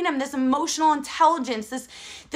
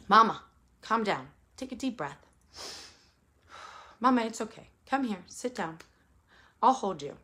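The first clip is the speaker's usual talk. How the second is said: soft and hushed, calming mama; gentle soothing child; 3 semitones lower, 7 dB quieter than usual. Retold or imitated. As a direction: quietly soothe her like her little boy. Son calming angry mother.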